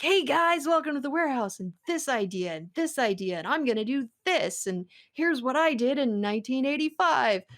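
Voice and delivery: lightly hyped carnival barker; a hint of a fairground pitchman. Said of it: "he talks like a carnival barker".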